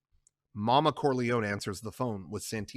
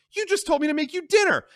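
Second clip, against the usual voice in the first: much higher, louder, fast; shrill rage; 7 dB louder, faster than usual. Acted. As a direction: throw it back at him. Connie rages.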